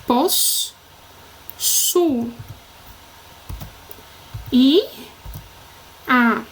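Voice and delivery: Pos, su, i, a with long pauses; each of Pos, su, i, a said alone; syllable by syllable. Shown as a demonstration. Teacher splitting the double S, Pos, su, i, a separately. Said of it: "Eu vou separar os dois S's".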